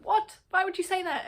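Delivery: loud, high burst of disbelief; outraged disbelief. Real, not acted. Reader protesting a character's baffling reply, incredulous. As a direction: blurt it out, incredulous, easing off by the end.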